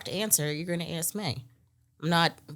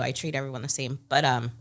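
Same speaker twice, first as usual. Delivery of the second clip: real tears welling, voice dropping low; quietly choked up, holding it together; tearing up; 5 semitones lower, 4 dB quieter, faster than usual. Real, not acted. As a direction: talk softly through welling tears. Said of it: "I'm tearing up right now".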